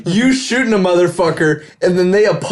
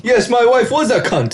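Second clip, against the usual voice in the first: mock Russian accent; 8 semitones higher than usual. Mock Russian shooter reply.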